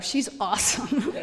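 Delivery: ordinary talk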